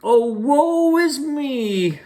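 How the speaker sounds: very high, slow hammed-up wail; disguised girl wailing. Willie disguised as Gretel wails to lure the cookie, very high and slow. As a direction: overact a lost little girl. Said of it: "cried Willie".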